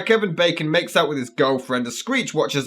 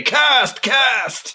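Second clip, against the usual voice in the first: hammy higher cry of doom; mock doom prophet; 7 semitones higher than usual. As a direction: cry doom like a crazy old man. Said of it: "This place is cursed".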